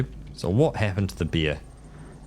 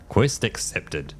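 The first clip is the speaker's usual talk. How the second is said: ordinary talk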